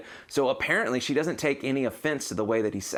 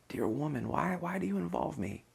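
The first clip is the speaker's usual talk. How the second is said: much softer, gently voiced question; gentle quote; 5 semitones higher, 7 dB quieter than usual. Jesus to his mother at the wedding, soft and gentle. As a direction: ask it softly, as a gentle question. Quoting Jesus again, softly.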